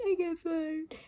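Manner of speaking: high-pitched pretend whine; mock wail. Vlogger imagining herself back in three weeks, mock wailing.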